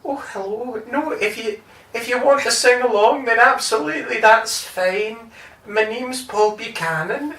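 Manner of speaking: soft, high, gently hesitant voice; shy, softly spoken singer greeting the crowd. Retold or imitated. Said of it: "and just kind of went".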